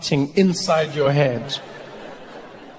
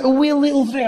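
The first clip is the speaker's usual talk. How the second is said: high Scottish lilt; coaxing Scottish friend; 10 semitones higher, 4 dB louder than usual. Acted. Scottish friend tempting comic to the pub, high coaxing lilt. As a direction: tempt with a cute Scottish accent. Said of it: "It was like".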